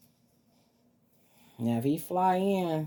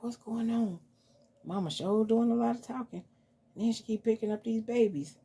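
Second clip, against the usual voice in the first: higher puzzled pet voice; voiced pet; 6 semitones higher than usual. Mama voicing the pet, higher and puzzled. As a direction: play the pet, baffled and a bit put out.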